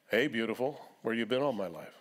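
lightly acted bar flirt; mock smooth-talker at the bar; cheesy pickup line. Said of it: "It won't be that cheesy".

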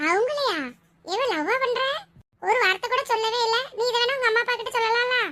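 high-pitched, surprised friend; astonished friend. Acted. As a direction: react in high-voiced disbelief to the secret. Friend astonished at secret love. (ஆச்சரியப்படும் தோழியின் உயர்ந்த குரல்)

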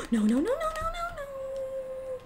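very high no's in rising alarm; panicked squeal. Real, not acted. Dying in the game, a high panicked squeal.

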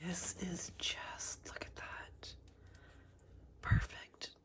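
soft hushed whisper. Real, not acted. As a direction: whisper so no one wakes. Whispering in the Airbnb.

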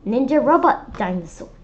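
plain reading voice

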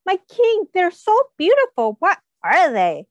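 high, loud gushing over tomatoes; mock-courtly awed exclamation; awed admiring courtier. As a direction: gush to the king in wonder. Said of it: "This person said".

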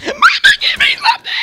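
put-on shrieking high toddler wail; bawling toddler. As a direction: scream for mama like a two-year-old. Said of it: "his little brother, who's, like, two".

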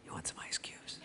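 secret whisper, no voice; whispered offer. Retold or imitated. Brother whispers an offer to the suffering pledge. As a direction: slip the offer quietly out of earshot. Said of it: "And he goes".

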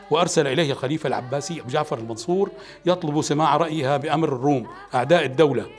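plain reading voice

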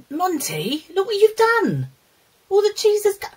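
high, loud telling-off of a naughty dog; exasperated scolding. Real, not acted. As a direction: scold the dog sharply, rising in alarm. Scolding the dog that spilled cheese.

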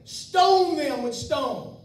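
slow, loud, drawn-out mob cry; the crowd roaring for stoning, slow and loud. Retold or imitated. Congregation's cry quoted.

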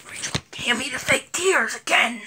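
hushed off-camera hiss; whispered order. Real, not acted. Vlogger secretly asking the helper for fake tears, whispering. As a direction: whisper it so the viewers won't hear.

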